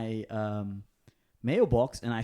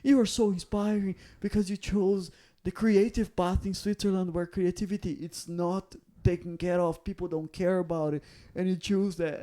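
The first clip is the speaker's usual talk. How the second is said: higher, gushing admirer praising his bravery; gushing fan voice; 7 semitones higher than usual. Retold or imitated. The model fan praising the comedian, high and gushing. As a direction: play the starstruck fan who can't stop complimenting. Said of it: "And he's like".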